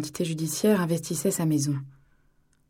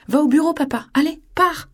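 put-on high, loud childish outburst; angry little girl; 9 semitones higher, 8 dB louder than usual. Daughter furiously telling her father to leave. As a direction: shove him away with a child's cry.

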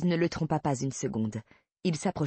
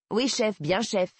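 higher, cheeky yes-sir reply; mock obedience; 5 semitones higher, slower than usual. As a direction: salute him with your voice, in jest. Mock obedience to his orders.

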